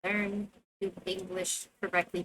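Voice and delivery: usual speaking voice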